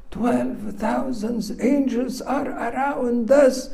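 high-pitched, wonderstruck exclamation; acting out a disciple suddenly reassured; amazed relief. Gehazi sees twelve thousand angels protecting them, high-pitched amazement.

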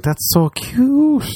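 put-on high, slow baby-talk coo over a dog. Partygoers cooing at a tiny dog in a bar. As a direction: coo it like a charmed onlooker.

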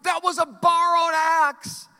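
high, loud panicked cry of loss; anguished cry. Acted. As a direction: cry out in sudden dismay. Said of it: "he cried".

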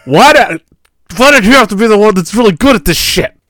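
high, loud exasperated rant; exasperated wife. Retold or imitated. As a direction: rant in frustration. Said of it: "she's like".

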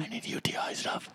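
hushed, embarrassed whisper. Retold or imitated. Friend mocks him whispering his embarrassing request. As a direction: breathe it out secretly. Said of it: "I, I'm".

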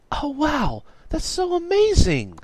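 high-pitched gushing amazement; squealing girlish awe; very impressed. A narrator's reading. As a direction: gush in a high, excited voice. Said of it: "Miranda was so impressed".

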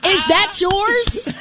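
high, drawn-out mock curiosity; mimicked nosy question. Nosy colleague asking about her hair, mock prying. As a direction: stretch it out, prying and mocking.

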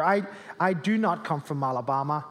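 ordinary talk, nothing marked

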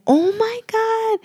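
very high, drawn-out squeal; mock yell. Sister-in-law seeing the test result, retold mock yell. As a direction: hold it high and long without full volume. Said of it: "she yells".

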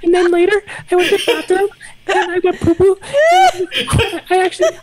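put-on high kid voice telling a story; little boy voice. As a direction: chatter like a small child. Plays a little boy named Tim.

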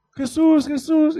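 blind man's loud, high call. Acted. Blind man calling Jesus for help, loud calling out. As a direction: cry out for attention, high and loud. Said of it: "y le llama".

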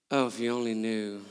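private thought. Retold or imitated. His private reply to her praise.

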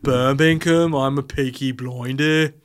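slow put-on Brummie; hammed-up Peaky Blinders Brummie; mock Birmingham accent. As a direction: have another go at a broad Birmingham accent. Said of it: "what did it sound like?".

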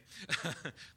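short, high chuckle; brief laugh to ease the request. Real, not acted. Teacher asking the class to go along, chuckling.

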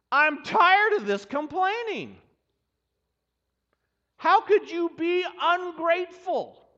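mock exasperated God. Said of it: "That's the response that I would expect".

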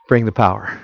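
quiet, low plea for the power; soft plea. Retold or imitated. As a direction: say it softly, almost to yourself. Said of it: "I suspect they were praying".

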